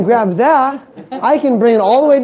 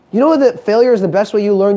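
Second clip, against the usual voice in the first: ordinary talk, nothing marked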